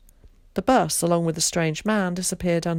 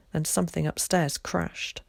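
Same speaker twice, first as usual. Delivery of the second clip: ordinary delivery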